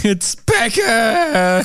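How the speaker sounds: high, slow, drawn-out put-on wail; mock distress. Joking call for help in a heart attack, mock distress. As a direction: stretch it out high like a hammed-up cry for help.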